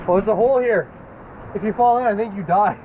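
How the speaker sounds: ordinary talk, nothing marked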